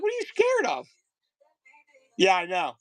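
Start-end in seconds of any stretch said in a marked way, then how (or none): 0.0-0.9 much higher, mock coaxing; coaxing his son. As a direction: egg the kid on lightly. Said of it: "I'm like".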